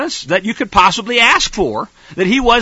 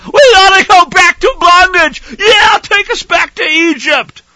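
high, loud mocking whine; whining Israelites; 12 semitones higher, 8 dB louder than usual. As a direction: mimic the crowd begging to go back.

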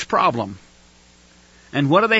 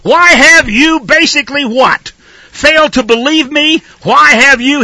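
loud, higher, accusing demand; angry God; 6 semitones higher, 6 dB louder than usual. Acted. God rebuking his people through the preacher, angry loud demand. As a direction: hurl the question like an angry God. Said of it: "you're right, 'cause he was".

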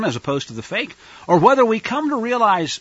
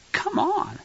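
exasperated; 12 semitones higher, 6 dB quieter than usual. Real, not acted. Exasperated aside.

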